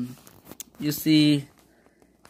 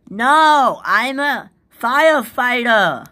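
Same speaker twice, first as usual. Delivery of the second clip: loud high scream; 6 semitones higher, 6 dB louder than usual. Acted. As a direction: yell it out as if in alarm. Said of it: "Firefighters don't scream".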